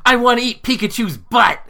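very high, loud imitation of a boy; shouting kid. Son's friend blurting a joke to the party, high shouted kid imitation. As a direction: yell it out in a mock child's voice. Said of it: "my son's friend instantly was like".

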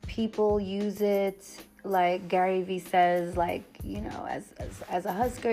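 ordinary delivery

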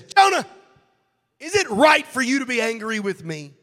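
God's loud, high, stern rebuke; booming accusation, voice thrown up high; angry God. Acted. God angrily confronting Jonah, loud high rebuke. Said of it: "That's not what he said".

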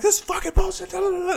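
very high reenacted rant; angry, near tears. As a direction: squeal out a retold furious protest, trailing into filler syllables. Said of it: "literally so angry, I'm almost in fucking tears".

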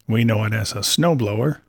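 plain reading voice

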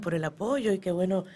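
normal talking voice

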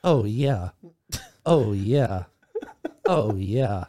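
very low, quiet, throaty "Oh, yeah". Acted. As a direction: drop it deep into the throat. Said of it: "It's almost Christopher Walken".

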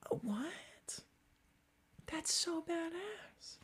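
high breathy whisper of amazement; awed whisper. Real, not acted. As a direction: whisper it in awe. Mock awe.

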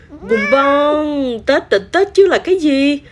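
high, emphatic scolding; exasperated pot. A narrator's reading. Pot exasperated by kitten's ignorance.

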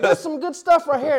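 high, impressed country guard. Retold or imitated. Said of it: "He's like".

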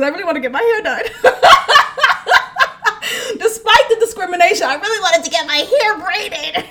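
high, loud laughter; a high, loud laugh carrying the line. Real, not acted. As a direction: laugh through the words. Laughing at herself.

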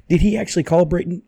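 ordinary talk

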